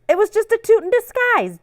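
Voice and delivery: high, sing-song reveal; playful punchline. Acted. Comic punchline.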